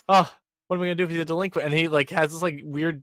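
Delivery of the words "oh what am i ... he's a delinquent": high, rushed mock panic; hammed-up worried classmate; mock fretting. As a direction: fret it out fast and high, playfully dramatic. Frets in a character's voice.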